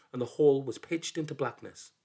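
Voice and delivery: ordinary delivery, nothing marked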